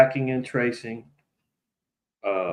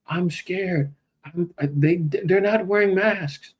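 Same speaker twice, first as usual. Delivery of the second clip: mock scared; 7 semitones higher, slower than usual. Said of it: "nervous neighbors were saying".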